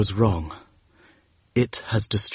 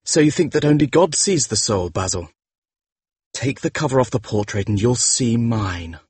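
soft, higher, chilly invitation; cold and cruel; 5 semitones higher, 3 dB quieter than usual. A narrator's reading. Dorian dares the painter to uncover the portrait, coldly cruel. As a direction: taunt him quietly, without pity. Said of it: "The voice that spoke was cold and cruel".

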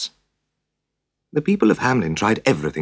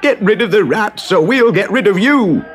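angry mob threat; 14 semitones higher, 6 dB louder than usual. Acted. Crowd threatens mayor.

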